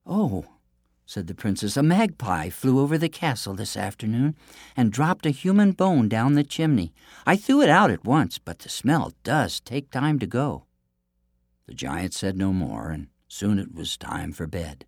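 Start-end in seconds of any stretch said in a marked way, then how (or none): 0.0-10.7 high, sweet innocent princess; theme park princess. Acted. Princess deceives giant.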